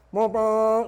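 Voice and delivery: loud, high honking horn noise; imitated vuvuzela blast. Monk blows his vuvuzela on the train, imitated blast. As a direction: blare like a plastic horn. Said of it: "starts going".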